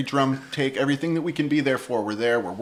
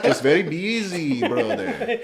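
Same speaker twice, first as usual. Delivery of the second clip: put-on slow, higher Colombian-accented excuse; Gustavo; 6 semitones higher, slower than usual. Bandmate excusing himself for not listening, slow Colombian accent. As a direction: imitate a Colombian friend begging off, amused. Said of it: "my very best impression of him".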